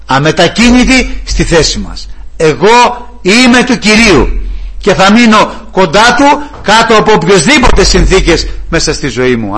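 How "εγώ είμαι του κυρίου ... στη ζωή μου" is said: steadfast believer. Acted.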